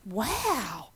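breathless whispered wow, then an excited burst; hushed amazement rising to a gleeful cry; thrilled gasp. Retold or imitated. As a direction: gush with delight at the quick call-back. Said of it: "And I was like".

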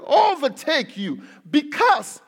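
slow, weighty reading with loud punched phrases; emphatic scripture reading. Real, not acted. Preacher reads the verse to congregation, slow and emphatic. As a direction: proclaim the verse slowly, swelling on key words.

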